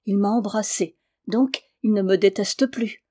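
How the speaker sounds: ordinary delivery, nothing marked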